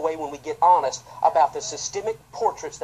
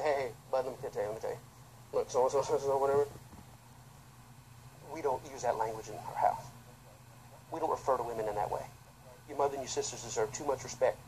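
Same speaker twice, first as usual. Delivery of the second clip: lower, softer stern father; fatherly talk; 7 semitones lower, 11 dB quieter than usual. Retold or imitated. President quietly correcting his son at home, lower and softer.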